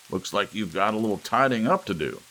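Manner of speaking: a lightly played grandfather, booming; old Gramps. A narrator's reading. Gramps addressing Lou over the mess, loud. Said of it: "said Gramps at last".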